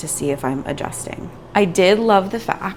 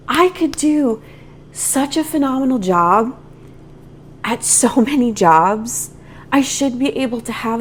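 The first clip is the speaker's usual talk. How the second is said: higher, intense outburst of frustration; emotional; 6 semitones higher than usual. Real, not acted. Vlogger venting frustration to viewers, emotional and higher. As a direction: push it out, heated and emotional. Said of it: "Now I'm getting emotional again".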